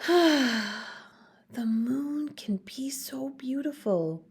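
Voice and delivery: slow, dreamy whisper; sighing whisper. Acted. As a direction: breathe it out in wonder at the moon. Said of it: "he whispered with a sigh".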